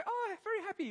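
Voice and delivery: very high, eager retelling; eager echo. Retold or imitated. Lecturer echoes student's polite answer, eagerly high. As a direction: repeat it back in a high voice.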